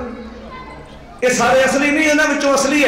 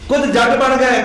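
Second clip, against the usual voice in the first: ordinary delivery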